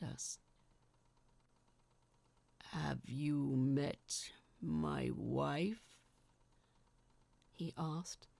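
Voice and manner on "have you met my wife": low, slow giant voice; giant's voice. Acted. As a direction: rumble it out slowly like a gentle giant. Giant speaks, voiced figure.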